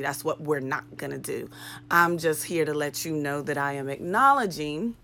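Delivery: ordinary talk, nothing marked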